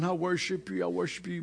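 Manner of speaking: softly imitating strained devotion; mock worshipful murmur. Preacher fighting to keep worshiping, soft prayer. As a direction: keep praying softly, forcing the focus. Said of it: "it was so hard".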